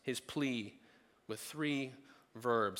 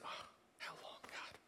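"how long" whispered with no voice; a spent whisper of "how long, God"; weary whisper; 20 dB quieter than usual. Shown as a demonstration. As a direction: breathe out "how long" as a last plea. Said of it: "all you have to say at that point".

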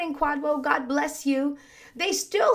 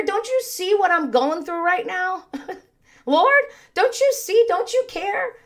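panicked plea; 8 semitones higher than usual. Retold or imitated. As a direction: cry out to God like a frightened disciple in the storm. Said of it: "That response?".

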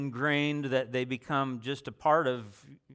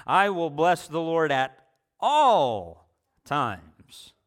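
"all" said loud and drawn out at the end; stress on all; 2 semitones higher, 7 dB louder, slower than usual. Shown as a demonstration. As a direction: build to a loud, stretched "all times". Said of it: "change the emphasis around on different words".

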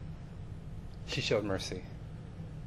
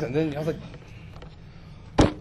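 ordinary talk, nothing marked

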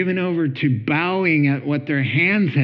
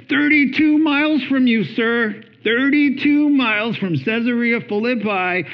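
exasperated; 9 semitones higher than usual. Retold or imitated. Jesus scolding the father, exasperated and raised. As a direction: hammer home the distance.

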